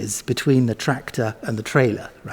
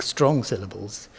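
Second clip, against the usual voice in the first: ordinary talk, nothing marked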